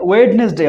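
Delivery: ওয়েডনেসডে as wed-nes-day; wrong pronunciation. Shown as a demonstration. Teacher repeats the common mistake, D sounded in ওয়েডনেসডে. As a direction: voice the first D in ওয়েডনেসডে. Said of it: "অর্থাৎ উচ্চারণ ভুল করে".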